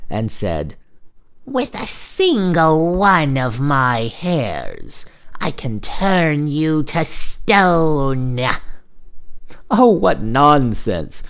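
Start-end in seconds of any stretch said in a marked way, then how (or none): 1.4-8.4 slow, high witch voice, vowels drawn out; scowling old witch. Acted. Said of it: "She scowled at the young man". 9.7-11.2 slow, higher-pitched exclamation of scorn; scornful cry. Acted. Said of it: "cried he".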